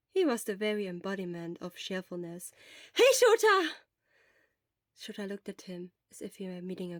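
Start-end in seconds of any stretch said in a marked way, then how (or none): 2.9-3.8 bright, high, loud greeting; cheerful call. Acted. Hisashi calling out to Shota at the school gates, loud and bright. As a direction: call out happily across the gate.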